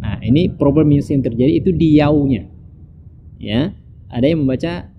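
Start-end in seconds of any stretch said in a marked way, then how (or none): none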